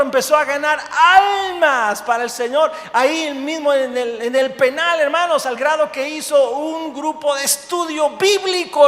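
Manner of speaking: high, shouted sermon delivery; full-force preaching. Acted. Preacher tells brothers of souls won in prison, full-force preaching. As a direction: rouse the congregation with loud, driving exclamations.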